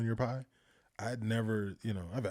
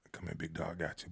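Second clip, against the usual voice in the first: conspiratorial; 5 semitones lower, 8 dB quieter than usual. Retold or imitated. Sneaking cream to him secretly.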